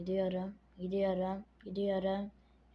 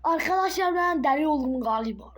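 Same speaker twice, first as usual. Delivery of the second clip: high, loud burst of excitement; excited; 7 semitones higher, 10 dB louder than usual. Real, not acted. Excited claim to viewers.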